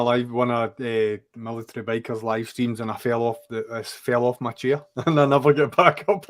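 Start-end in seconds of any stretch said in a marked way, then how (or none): none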